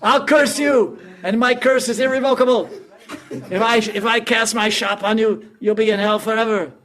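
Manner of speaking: high, loud comic wail of a cheated Brahmin; yelling Brahmin clown. Acted. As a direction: shriek and threaten like a pompous clown. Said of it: "and he's yelling".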